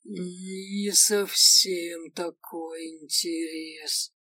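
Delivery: slowly drawn out; slow drawl. Acted. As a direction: stretch every word slowly. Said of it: "медленно протянул орк".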